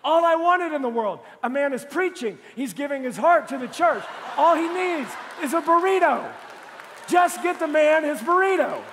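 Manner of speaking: loud, high preacher's rant; mock outrage. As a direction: plead your case with hammed-up indignation. Mock outraged plea for his burrito.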